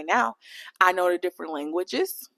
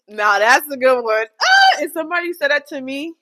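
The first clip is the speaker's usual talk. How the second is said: loud, higher excited outburst; excited shout; 5 semitones higher, 5 dB louder than usual. Real, not acted. Reacting to a compliment she loves, excited loud outburst. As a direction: burst out thrilled.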